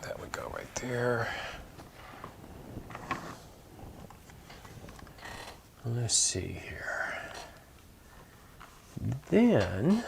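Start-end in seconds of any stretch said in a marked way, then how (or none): 0.0-7.2 whispered to herself; hushed thinking aloud; whispering. Real, not acted. Whispering to herself while arranging.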